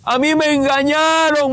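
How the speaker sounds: imitated pained protest; acted lament. Dead father's lament, acted in testimony.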